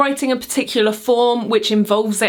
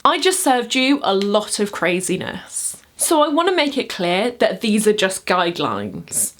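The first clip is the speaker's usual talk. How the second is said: ordinary talk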